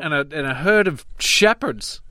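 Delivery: high-pitched comic British voice; Slap Slapley. Acted. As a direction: pitch it way up, like a silly Brit. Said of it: "can we get Slap Slapley in here".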